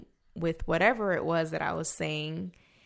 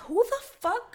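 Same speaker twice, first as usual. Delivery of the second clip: shrill, shocked squawk; high outraged who-the-fuck; outraged disbelief; 4 dB louder than usual. Retold or imitated. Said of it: "I'm like".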